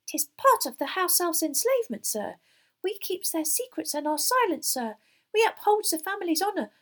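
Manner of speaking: high, squeaky, earnest elf voice; high-pitched dutiful elf; serious house-elf. A narrator's reading. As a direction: explain solemnly as a tiny servant elf. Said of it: "looking suddenly serious".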